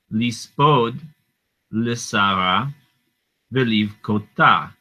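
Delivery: slow word-by-word Hebrew scripture chant; reading the verse aloud to students. Shown as a demonstration. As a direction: read each Hebrew word with a pause. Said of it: "let's continue with verse two".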